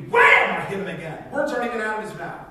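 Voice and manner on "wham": shouted high punch sound effect; loud, high punch sound; loud wham. Acted. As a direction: slam it out like a punch landing. Acting out punching Pastor Dan.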